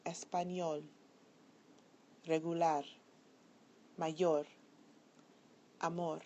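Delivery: ÑOL, LAR, YOR, MOR stressed in Español, regular, mayor, amor; last-syllable stress. Shown as a demonstration. Teacher says listen carefully, stressing the last syllable of Español, regular, mayor, amor. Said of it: "the stress falls on the last syllable".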